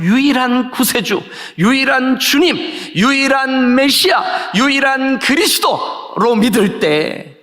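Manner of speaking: high, drawn-out proclamation; slow, stretched pulpit crescendo; preaching climax. Real, not acted. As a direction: build each title into a ringing cry.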